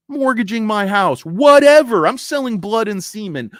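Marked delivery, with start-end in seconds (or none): 1.2-2.1 loud, high dismissive whatever; mock shouted whatever. Tough trader brushing off risk, loud dismissive shout. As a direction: yell it off like nothing matters.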